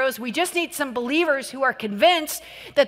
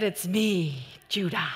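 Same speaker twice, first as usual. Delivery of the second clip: put-on low, soft proud little boy; deadpan self-introduction as a kid; lightly voiced kid; 7 semitones lower, 5 dB quieter, slower than usual. Dad voicing son Judah introducing himself, low and soft. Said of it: "he said".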